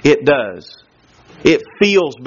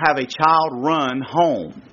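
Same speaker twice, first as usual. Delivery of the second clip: ordinary talk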